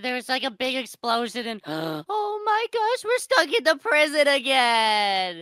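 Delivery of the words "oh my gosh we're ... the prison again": gasp, then stretch out the horror slowly; slow play-acted shock; dismayed shock. Finds they are imprisoned again.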